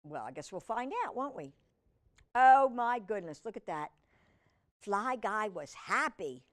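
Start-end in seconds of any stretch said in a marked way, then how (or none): none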